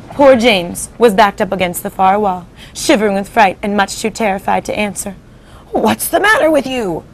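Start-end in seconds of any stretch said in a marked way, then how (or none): 5.7-7.0 put-on old grasshopper. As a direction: ask it like a concerned old insect. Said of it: "the old green grasshopper said".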